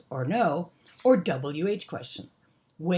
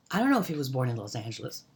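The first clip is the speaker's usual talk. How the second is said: quick, run-together casual speech; really fast; 4 semitones lower, much faster than usual. Shown as a demonstration. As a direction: rattle it off fast, words blending together. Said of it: "we say it really fast".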